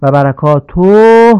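«برکاته» drawn out loudly; loud, stretched «برکاته»; wrong stretched ending. Shown as a demonstration. Teacher showing the stretched «برکاته» mistake. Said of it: "نیز درست نیست".

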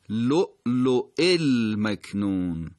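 لؤلؤ المکنون slowly, "luʾ-luʾi-l-maknūn"; hamza with kasra. Shown as a demonstration. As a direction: stop on first hamza of لؤلؤ, link second. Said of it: "روی واو اما کسره گرفته".

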